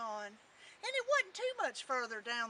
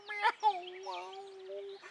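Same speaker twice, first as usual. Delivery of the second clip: high, long cat wail; tomcat meow; 6 semitones higher than usual. A narrator's reading. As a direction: meow mournfully like an old tomcat. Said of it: "It was an old tomcat".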